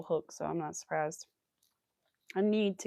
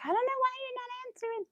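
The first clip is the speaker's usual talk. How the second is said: high, quick impression of mom; mom's voice; faster than usual. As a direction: imitate an exasperated mom, pitched way up. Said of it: "my mom was calling me".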